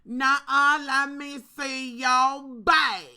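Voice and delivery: loud, slow, drawn-out demand; mock cashier demanding the bag; sassy cashier. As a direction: insist loudly, stretching every word. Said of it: "She was like".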